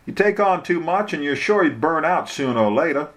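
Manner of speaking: knowing local lawyer, voice lifted; Bubba, advising. Acted.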